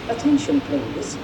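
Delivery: usual speaking voice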